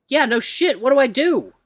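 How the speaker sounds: high, loud sarcastic panic; panicky snap. Acted. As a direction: bite back in a panic. Panicking at the chain.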